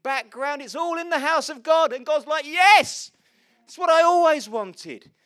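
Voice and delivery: loud, high, building to a roaring climax; full-force preaching. Real, not acted. Preacher celebrating that God made everyone different, full-force preaching. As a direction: preach it at the top of your voice.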